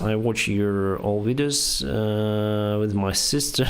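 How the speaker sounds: ordinary delivery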